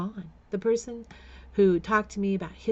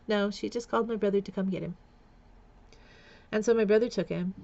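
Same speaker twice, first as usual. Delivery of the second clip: ordinary talk, nothing marked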